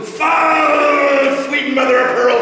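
high shouted oath at a pest bird; frustrated pirate curse. Acted. Sailor cursing the seabird that keeps returning, exasperated shout.

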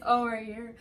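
ordinary delivery

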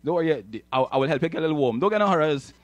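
ordinary talk, nothing marked

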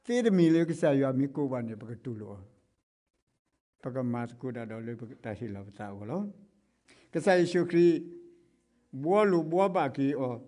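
ordinary talk, nothing marked

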